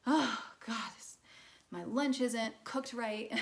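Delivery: a playful put-on irritated gripe; mock irritation. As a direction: grumble about the lunch like it ruined your day. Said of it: "just feeling irritated".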